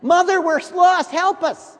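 put-on high, loud panicked girls' cries; shouting out. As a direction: squeal it out like lost schoolgirls calling for help. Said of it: "they were sort of shouting out".

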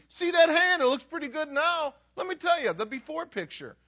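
high, loud show-and-tell; acted eager bragging, loud and high; excited, thrilled. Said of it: "but he's excited. He's thrilled".

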